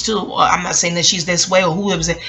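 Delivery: ordinary talk, nothing marked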